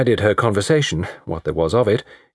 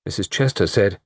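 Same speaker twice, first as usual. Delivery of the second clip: plain reading voice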